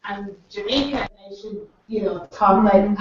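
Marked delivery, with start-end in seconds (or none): none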